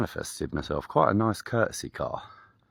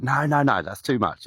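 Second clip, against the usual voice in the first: high, louder flustered refusal; protesting man; 8 semitones higher, 5 dB louder than usual. Retold or imitated. Grateful man refusing the lift, flustered and high. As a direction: protest like it's far too kind.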